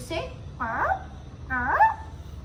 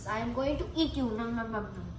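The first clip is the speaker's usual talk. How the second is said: low, softer mock threat; playful snake menace; 4 semitones lower, 7 dB quieter, much faster than usual. Born snake teasing child, low playful menace. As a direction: pretend to gobble someone up.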